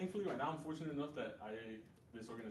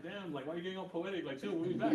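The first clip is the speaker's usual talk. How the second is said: louder, playful protest building up; mock exasperation; 5 semitones higher, 7 dB louder than usual. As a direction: tease them for being dramatic. Teasing retort to a poetic complaint.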